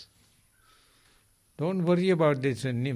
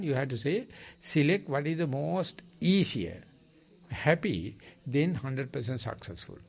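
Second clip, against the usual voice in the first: normal talking voice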